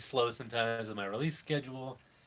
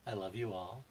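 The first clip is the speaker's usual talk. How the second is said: nasally sexy voice; 6 dB quieter than usual. Acted. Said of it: "my nasally sexy voice".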